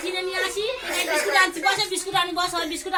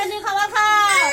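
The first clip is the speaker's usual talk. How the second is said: slow, louder, stern order to eat; firm slow command; 4 dB louder, slower than usual. Real, not acted. Teacher insisting the child eat.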